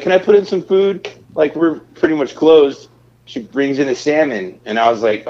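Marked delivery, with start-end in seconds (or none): 0.0-2.9 higher-pitched imitation of a coworker asking; imitated server. Server asking the cook for food at closing, imitated high-pitched. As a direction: put on a high pleading server voice.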